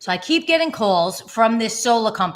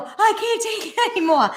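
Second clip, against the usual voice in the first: exasperated; 12 semitones higher than usual. Retold or imitated. Retelling her exasperation.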